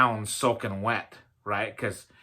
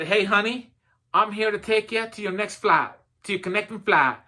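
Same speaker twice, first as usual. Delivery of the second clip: put-on higher-pitched amateur Southern accent; 6 semitones higher than usual. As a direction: imitate a Southern airline employee, a bit badly. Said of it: "she had kind of a Southern accent".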